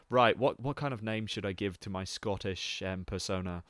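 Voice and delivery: put-on low, hesitant London accent; David Tennant. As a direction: play a London actor thinking up a Scottish alias. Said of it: "you can tell he's a fake Scottish man".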